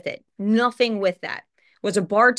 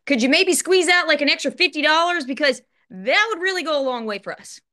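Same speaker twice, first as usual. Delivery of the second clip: higher-pitched, pleading ask for a favour; retold tentative plea, pitched up; 7 semitones higher than usual. Asking for extra rent money, pleading.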